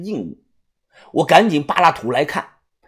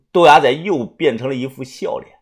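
ordinary delivery

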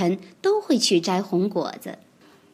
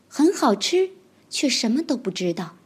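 usual speaking voice

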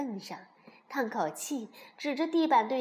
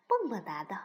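usual speaking voice

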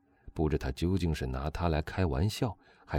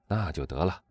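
plain reading voice

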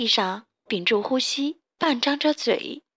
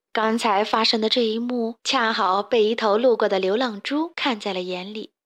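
ordinary delivery, nothing marked

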